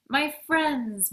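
loud, high-pitched welcome; hearty greeting. A narrator's reading. Postman greeting a girl and her dog, hearty greeting. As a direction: greet two dear friends heartily.

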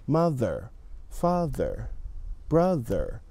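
mother, father, brother each with a light th; careful th. Shown as a demonstration. Teacher models the th words for students. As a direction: model each word, tongue just touching the teeth. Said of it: "tongue goes out a little bit".

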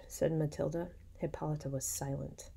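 usual speaking voice

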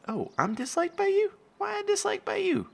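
high, indignant mock offense; mock hurt surprise. Real, not acted. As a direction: act wounded by the sim. Reacting to sim's dislike.

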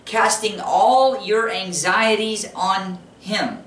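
slow emphatic repetition. Shown as a demonstration. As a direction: say it again slowly, stressing "all". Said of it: "Did you hear that?".

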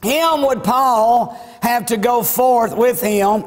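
usual speaking voice

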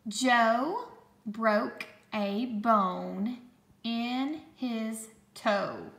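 slow, word-by-word dictation; slow, clear dictation voice; dictation pace. Shown as a demonstration. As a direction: read it out slowly for spelling. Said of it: "This sentence is".

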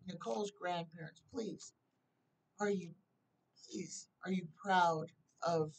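soft, hushed appeal to the spirits; prayer. Real, not acted. Softly prays to spirits for an answer.

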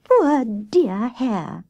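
very slow, high, sympathetic sigh; lingering, pitying rabbit voice. A narrator's reading. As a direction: draw it out with doting pity. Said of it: "sighed Grey Rabbit".

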